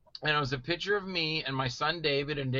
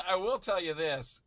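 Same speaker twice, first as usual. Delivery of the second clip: ordinary talk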